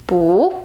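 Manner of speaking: "Bu" starting low and rising; second tone. Shown as a demonstration. Teacher showing changed bu: second tone on "Bu", low rising. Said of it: "bu changes to the second tone".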